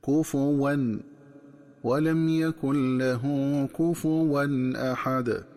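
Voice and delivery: كفوا said "kufuwan" with damma on the fa, slow chant; correct kufuwan. Shown as a demonstration. Teacher demonstrating the correct recitation, slow. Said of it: "تقرأ بضم الفاء هكذا".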